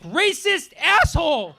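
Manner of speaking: shrill outraged yell; angry heckle. Retold or imitated. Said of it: "she was like".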